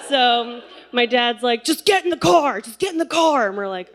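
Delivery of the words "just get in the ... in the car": high, forceful retold shout; yelling orders. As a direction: imitate dad shouting an urgent command. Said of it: "yelling orders at us".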